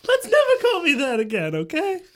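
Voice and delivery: very high, loud character voice; high, mock-horrified request; embarrassed Johann. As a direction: plead comically in a high pitch. Johann rejects being called Daddy.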